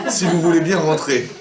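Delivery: slow, laughing, high fake courtesy; mock polite host. Hosts binning the guest's flowers, laughing with fake politeness. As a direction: put on a sugary welcome while laughing.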